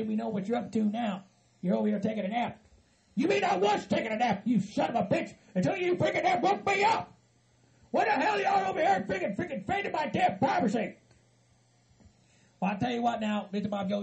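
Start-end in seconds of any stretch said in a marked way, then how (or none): 3.1-10.9 high-pitched, indignant complaint; angry outburst. Acted. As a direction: rant at the people who woke you. Furious at being woken up.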